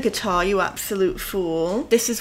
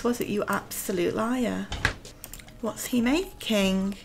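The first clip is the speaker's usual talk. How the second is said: ordinary delivery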